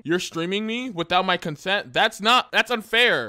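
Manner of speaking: mock outrage. Streamed without consent, protests.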